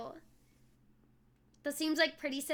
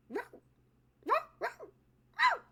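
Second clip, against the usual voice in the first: cartoon squawk; 13 semitones higher than usual. High cartoon voice playing the Donald bit.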